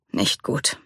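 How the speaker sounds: soft, subdued hoarse reply. A narrator's reading. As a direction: answer softly with a worn-out voice. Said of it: "ihre Stimme heiser".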